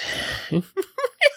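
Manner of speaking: high-pitched granny cackle; old lady's cackle. Acted. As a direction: cackle like a pleased old crone. Old woman laughs after her speech.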